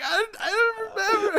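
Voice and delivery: high laughing mock whine; laughing whimper. Coworker whining through laughter, high and laughing. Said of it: "He's like".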